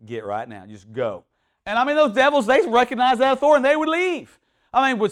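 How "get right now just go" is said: much softer and lower, quietly casting out; mild calm command. Retold or imitated. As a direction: dismiss the devil with quiet authority. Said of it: "He, he wasn't loud".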